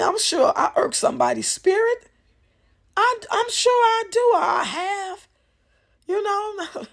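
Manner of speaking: high emphatic. Real, not acted. Admitting she irks people.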